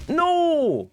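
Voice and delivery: high anguished no; frustrated shout. Real, not acted. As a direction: cry out in defeat. Dies in game.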